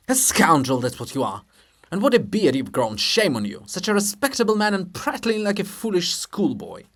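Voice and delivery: loud scolding rebuke; indignant. Acted. Shevchenko scolds Hrushevsky, loud and indignant. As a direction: scold him with outrage.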